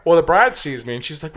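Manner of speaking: ordinary delivery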